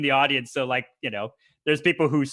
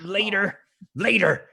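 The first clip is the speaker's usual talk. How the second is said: raised, short urgent call; acted urgent aside; 6 semitones higher than usual. Hurt wrestler puts off his opponent, acted urgent aside. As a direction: put off the argument mid-fight. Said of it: "he just kinda gravels you".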